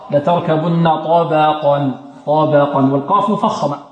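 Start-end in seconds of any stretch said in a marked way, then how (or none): 0.1-2.9 slow recitation, thin "ba" in طبقا between heavy letters; ترقيق الباء. Shown as a demonstration. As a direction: keep the ب of طبقا light. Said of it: "والباء مرققة". (تلاوة بترقيق الباء في طبقا)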